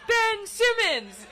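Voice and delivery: high, loud, stretched group yell; imitated crowd shout; band calling back. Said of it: "And the band goes".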